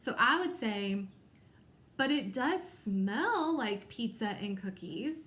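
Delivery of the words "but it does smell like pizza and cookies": "smell" stretched out with extra emphasis; stress on smell. Shown as a demonstration. Teacher showing students how to read italics, emphasis on "smell". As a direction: read slowly and expressively, leaning on "smell". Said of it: "listen to how I would read that".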